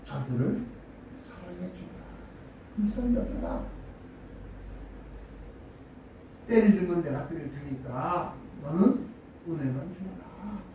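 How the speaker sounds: soft, low, gentle command; quiet tender voice of God; hushed voice of God. Acted. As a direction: let it fall almost to a whisper. Quoting God speaking to Jesus.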